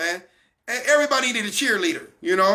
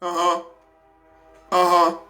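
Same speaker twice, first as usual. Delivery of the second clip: mock tuned-out husband; 4 semitones lower than usual. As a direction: lower dismissive uh-huhs. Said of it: "Fellas just sitting there going".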